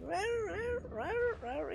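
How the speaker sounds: mock a useless little dog's bark, squeaky and high; imitated yappy dog bark. Imitating Rusty the dog's weak bark for viewers, high squeaky yaps. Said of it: "Rusty's bark is useless".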